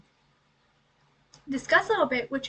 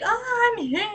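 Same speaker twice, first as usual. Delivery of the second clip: perky arrival; 9 semitones higher, 4 dB louder than usual. Retold or imitated.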